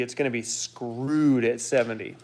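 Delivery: ordinary talk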